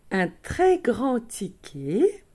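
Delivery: very slow, drawn-out retry after a stumble; deliberately slowed to get it out cleanly; slow, careful speech. Real, not acted. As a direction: take it slowly word by word, stretching "ticket" upward. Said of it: "je parlais doucement, ça ira mieux".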